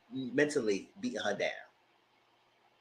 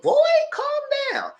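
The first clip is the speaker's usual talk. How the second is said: a shrill-pitched mock rebuke, way up high; high mock scolding. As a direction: play yourself scolding him, pitched way up. Speaker scolds the man in her retelling.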